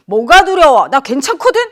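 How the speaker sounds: loud, stubborn bravado: 뭐가 두려워 thrown out; defiant denial. Acted. You defying your fear, with a loud stubborn shout.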